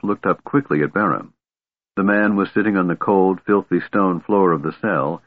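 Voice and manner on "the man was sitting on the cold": usual speaking voice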